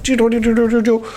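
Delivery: rapid stuttered high rattling noise; vocal effect of shaking a locked handle; imitated door rattle. Storyteller acting out Dan at the locked basement, rattling noise.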